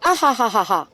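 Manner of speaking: high, loud mock cackle of a laughing rooster; cackling like the chicken that laughs at her; imitating chicken laugh. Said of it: "he laughs".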